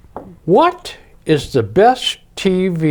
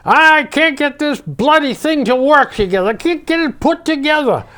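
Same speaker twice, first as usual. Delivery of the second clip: flustered Henry voice; 9 semitones higher than usual. Retold or imitated. Henry mumbling, fixing radio.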